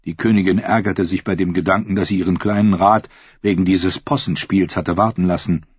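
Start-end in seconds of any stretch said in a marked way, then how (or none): none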